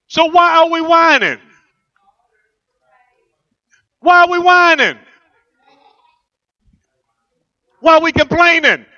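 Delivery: high, loud rebuke; fired-up pulpit question; rising preaching challenge. Real, not acted. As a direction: call them out for whining. Preacher scolding the church.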